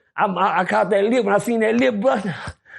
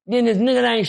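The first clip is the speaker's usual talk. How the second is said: usual speaking voice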